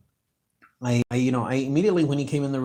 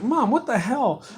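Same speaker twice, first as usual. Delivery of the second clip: high, stretched-out mock outrage; exasperated protest; 12 semitones higher, 6 dB louder than usual. Son to mom who keeps blocking him, exasperated. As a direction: act out pleading with a meddling mother, pitched way up. Acted protest to his mother.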